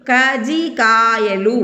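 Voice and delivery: "కజ్జికాయలు" drawn out slowly as "కజీకాయలు"; "కజ్జికాయలు", syllable by syllable, జ undoubled; single j, no doubling. Shown as a demonstration. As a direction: slowly say "కజ్జికాయలు" with only one జ. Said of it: "ఒకవేళ ఈ లేదనుకోండి".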